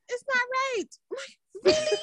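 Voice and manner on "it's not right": put-on whiny child. Son whining about socks.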